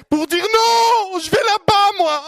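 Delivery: very high, loud, slow sermon cry; preaching at full force. Acted. Preacher to the congregation, slow high full-force cry. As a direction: throw the line to the back row.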